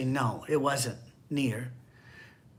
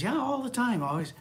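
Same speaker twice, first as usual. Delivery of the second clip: higher, agreeable wife voice; wife's voice; 9 semitones higher than usual. Retold or imitated. Pastor imitates wife agreeing in counseling, higher. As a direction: chime in eagerly as the wife. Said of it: "And the lady goes".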